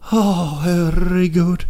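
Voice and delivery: high, drawn-out strained reply; mock-recovering from a coughing fit; strained reply after choking. Coffee drinker returning after choking, strained reply. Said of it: "you come back and you're like".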